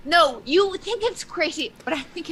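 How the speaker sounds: fast, loud and high; excited. Real, not acted. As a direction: rush it out eagerly. Excited insistence.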